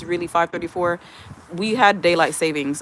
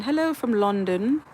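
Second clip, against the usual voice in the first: put-on playful, higher-pitched stab at a London accent; attempted London accent; 3 semitones higher than usual. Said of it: "I can't do your London accent".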